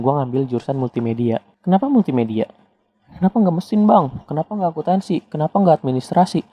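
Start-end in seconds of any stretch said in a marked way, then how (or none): 1.6-6.5 higher-pitched mock questioner, firing off questions; penonton bertanya-tanya. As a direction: imitate a viewer pestering with why after why. Menirukan pertanyaan penonton yang cerewet. (menirukan penonton bertanya dengan nada tinggi)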